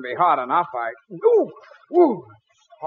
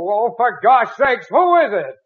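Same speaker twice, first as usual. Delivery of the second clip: exasperated; 6 semitones higher, 5 dB louder than usual. Acted. Annoyed, calling out.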